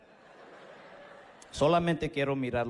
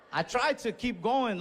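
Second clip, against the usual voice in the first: ordinary talk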